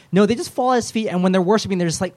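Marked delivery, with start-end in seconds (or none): none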